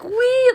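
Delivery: high-pitched "wee!" imitation; high mock squeal. Imitates girl grabbing her hair.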